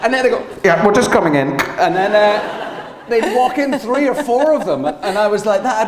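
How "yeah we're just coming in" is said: loud mock intercom announcement; studio talkback voice. Studio staff telling the singer they're coming in, loud imitated talkback voice. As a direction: imitate crew calling in over the talkback.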